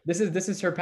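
normal talking voice

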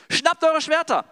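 hoch und laut rufen: Schwerter holen; Befehlsruf. Acted. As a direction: ruf deine Männer zu den Waffen. David ruft Männer zu Waffen. (lauter, hoher Befehlsruf)

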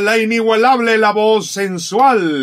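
big hyped radio announcer; announcer intro. Acted. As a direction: build it up like a show host.

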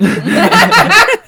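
loud high laugh; cracking up. Real, not acted. Bursts out laughing.

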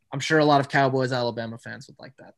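normal talking voice

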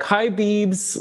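"beebs" with a long ee, pitched high; Lawrence Chaney's vowel. Shown as a demonstration. Contestant showing changed vowel, high "beebs" with long ee. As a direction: turn babes into high "beebs". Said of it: "Lawrence just changes the vowel entirely".

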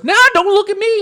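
hammed-up sassy TV host. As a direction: squawk it with exaggerated sass. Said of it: "with a little sassy voice".

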